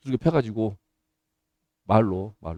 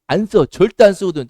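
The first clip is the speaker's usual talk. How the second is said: usual speaking voice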